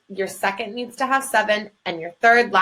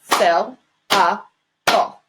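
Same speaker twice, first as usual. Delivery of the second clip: very slow, one syllable per clap, louder; clapped syllables; 4 dB louder, much slower than usual. Shown as a demonstration. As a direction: clap out Syl-a-ble beat by beat. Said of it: "clap the word out".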